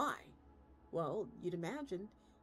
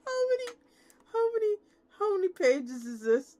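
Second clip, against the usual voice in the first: very high and loud, breaking; shrieking disbelief; 12 semitones higher, 10 dB louder than usual. Real, not acted. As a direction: squeal the question in horror. Shocked at rulebook length.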